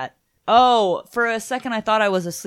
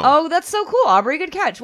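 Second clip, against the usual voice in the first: excited; 10 semitones higher, 4 dB louder than usual. Real, not acted. Praising co-host's catch.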